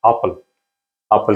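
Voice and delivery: एप्पल with an open a, apfel-like; German reading of एप्पल; German pronunciation. Shown as a demonstration. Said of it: "हम इसको पढ़ेंगे इस आवाज़ के साथ".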